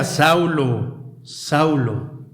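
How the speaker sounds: low, measured call with pauses; voz del Señor. Acted. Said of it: "una voz que decía". (voz pausada y grave del Señor)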